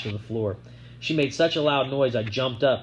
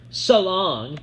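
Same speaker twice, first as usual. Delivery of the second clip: calling out; 7 semitones higher, 5 dB louder than usual. A narrator's reading. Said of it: "she called".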